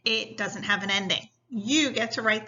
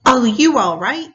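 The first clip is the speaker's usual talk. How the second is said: gasped; 13 dB louder than usual. A narrator's reading. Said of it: "Tierney gasped".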